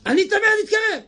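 high-pitched leper call; leper's cry. Acted. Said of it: "אמור לצעוק".

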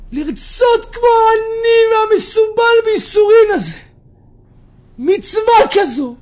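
high, loud wailing outburst; impassioned cry. Acted. Rabbi weeping over the sufferer's wish, high anguished cry. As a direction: wail it out loudly, stretching the last words. Storyteller cries out in impassioned retelling.

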